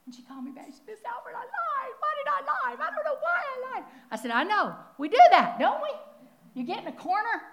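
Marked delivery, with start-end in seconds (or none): 0.9-3.8 high, pleading confession voice; caller voiced high. Speaker voicing a caller who lied, high pleading voice. 4.3-7.4 emphatic reply. Retold or imitated. Speaker's own reply, retold emphatically.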